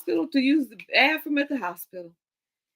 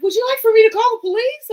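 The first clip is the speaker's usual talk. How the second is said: higher, louder voice of a helpful stranger; concerned stranger; 12 semitones higher, 9 dB louder, faster than usual. Retold or imitated. Man in the next car offering to call police, higher and louder.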